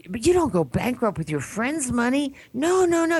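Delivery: high, rising disbelief; acted indignant protest. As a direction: object urgently, pitch climbing on each no. Acts out protest to the debtor.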